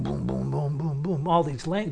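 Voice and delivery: ordinary delivery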